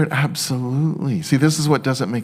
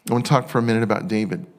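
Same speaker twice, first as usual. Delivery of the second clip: ordinary talk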